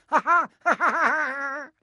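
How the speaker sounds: very high gleeful cackle; mocking cackle. A narrator's reading. Peeves laughing at Filch, gleeful cackle.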